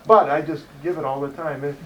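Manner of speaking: ordinary talk, nothing marked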